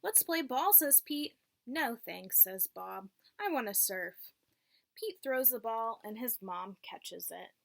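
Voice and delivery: clear, lilting read-aloud voice for a class; teacher reading a picture book to the class; reading to children. Acted. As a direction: read it like storytime for little kids.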